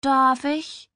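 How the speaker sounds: high, lilting wheedle; sweetly pleading. A narrator's reading. Little sister begs to borrow clothes, sweetly. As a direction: coax her with a sugary voice. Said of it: "fragte mit liebenswerter Stimme".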